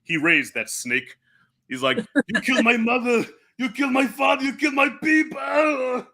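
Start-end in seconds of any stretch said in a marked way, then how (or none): 2.3-6.0 Conan's vengeful yell. Retold or imitated. Conan yelling at Thulsa Doom.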